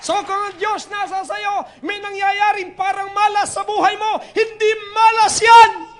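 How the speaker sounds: rally the crowd with rising force; preaching at full force. Real, not acted. Preacher shouting to congregation. (lumalakas na sigaw ng pangangaral)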